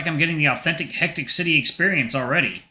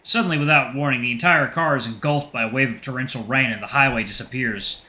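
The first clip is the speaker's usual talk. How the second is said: ordinary talk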